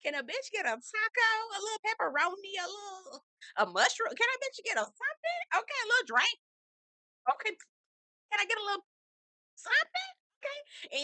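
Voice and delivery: very high, pleading comic squeak; mock high whine. Mocking herself begging for food, high whiny plea. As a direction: beg for food in a tiny high voice. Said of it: "I was like".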